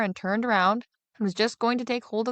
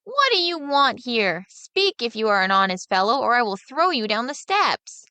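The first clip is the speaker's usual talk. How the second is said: cry; 4 semitones higher, 4 dB louder than usual. Acted. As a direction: threaten the figure at full voice. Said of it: "The boy cried a second time".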